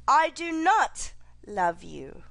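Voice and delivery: "not" punched, slow; slow denial, weight on "not"; stress on NOT. Shown as a demonstration. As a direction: hit the word "not" hard.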